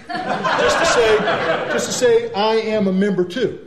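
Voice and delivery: high, loud, laughing defiance; a laughing I-belong-here. Real, not acted. Defiant point to Legionnaires.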